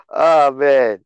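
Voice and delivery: usual speaking voice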